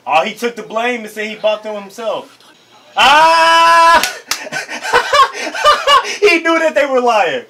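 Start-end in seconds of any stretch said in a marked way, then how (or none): 3.0-7.4 high, loud burst of laughter; laughing outburst. Real, not acted. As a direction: cackle in disbelief, then laugh through the words.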